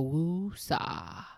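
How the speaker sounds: drawn-out woosah, letting the air go; slow woosah exhale. Shown as a demonstration. Coach showing parents how to woosah, slow. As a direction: stretch woosah out slowly on one long calming breath. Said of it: "the first thing I wanna say is woosah".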